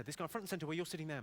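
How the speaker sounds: usual speaking voice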